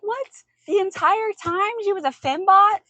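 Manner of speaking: high, loud retold outcry; upset disbelief. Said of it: "I was so upset".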